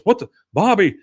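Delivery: dad startled by the leaves; startled call. Retold or imitated. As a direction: call out the name in shock. Hank shocked at unraked leaves.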